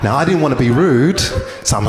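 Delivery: ordinary talk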